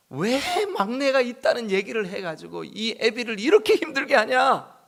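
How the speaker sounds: high, loud pained cry of a grieving father; hammed-up father's loud lament; anguished father. Father cries out at his sons.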